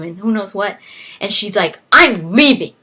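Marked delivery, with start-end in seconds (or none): 1.9-2.7 loud declaration. Retold or imitated. Wife storming out.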